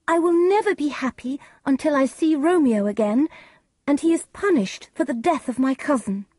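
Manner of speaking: put-on high-pitched, distressed young bride; high, emphatic grieving daughter; Juliet. A narrator's reading. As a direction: raise the voice high, insisting through grief. Juliet answers her mother in a story.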